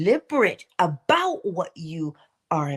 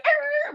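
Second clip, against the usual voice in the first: high yelp of frustration; a sudden hammed-up growl; short mock snarl; mock angry growl. Imitating cart rage.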